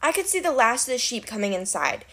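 plain reading voice

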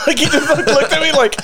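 high, laughing through the words; can't stop laughing while telling; speech breaking into laughter. Real, not acted.

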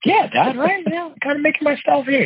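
high-pitched, proud and cocky; excited. Retold or imitated. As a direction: squeak it out, pleased with yourself. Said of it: "I'm like".

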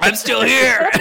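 high, loud, laughing through it. Real, not acted. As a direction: giggle out the words. Laughing echo after choking.

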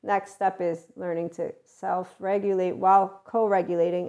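ordinary delivery

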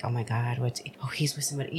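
hushed, fast whisper of disbelief; shocked whisper. Retold or imitated. Picturing a jealous reaction, whispering fast. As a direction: whisper it as if caught off guard.

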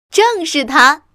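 high, loud cry of discovery; loud exclamation. A narrator's reading. Fox recognising the place he sought, loud. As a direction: call it out triumphantly. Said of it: "狐狸先生大声说".

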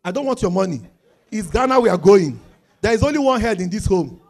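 loud mock rant insisting on Ghana; husband refusing his wife's offer, loud mock insistence; domineering husband. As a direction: bark it like the head of the house. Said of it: "the woman said".

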